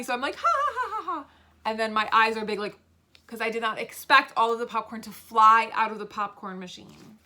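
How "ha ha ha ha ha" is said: high, acted ha-ha laugh; demonstrated funny laugh. Shown as a demonstration. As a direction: act out finding it funny, pitched high. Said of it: "I thought it was funny".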